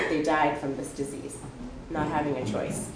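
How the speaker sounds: ordinary delivery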